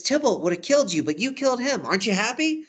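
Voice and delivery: high, emphatic acted reproach; theatrical exasperated pep talk; scolding Friar Lawrence. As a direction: chide with insistent rising questions. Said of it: "He says, he says".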